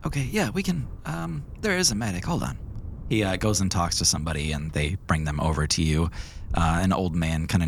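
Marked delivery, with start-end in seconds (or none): none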